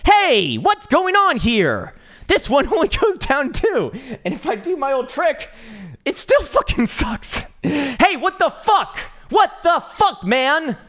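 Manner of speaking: very high cartoon voice, loud and indignant; high-pitched mock outrage; outraged squeaky voice. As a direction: squeal at the chart in disbelief.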